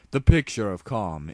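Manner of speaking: usual speaking voice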